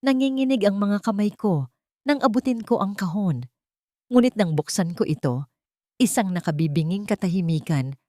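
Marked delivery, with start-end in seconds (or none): none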